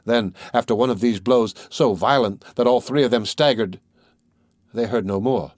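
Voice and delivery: usual speaking voice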